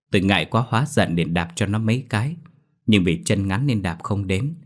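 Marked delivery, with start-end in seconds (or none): none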